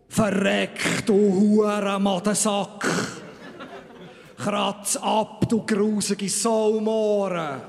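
over-the-top screaming tirade; mock fury. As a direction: hurl the insults in a hammed-up holy rage. Archangel hurls insults at audience.

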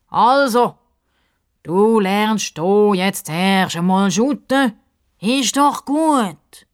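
high, slow, drawn-out advice; pompous sidekick Justus. A narrator's reading. Sidekick telling the girl to learn shooting first, pompous. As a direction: lay it out grandly, stretching the end.

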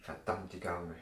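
soft, low curse under the breath; muttered curse. Acted. Doctor after hanging up on the clinic, a quiet muttered curse. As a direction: spit it out quietly to yourself.

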